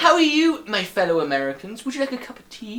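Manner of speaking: high, exaggerated fake British accent; bad British accent. American actor greeting fellow Americans over tea, bad British accent. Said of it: "You can tell they're American as well".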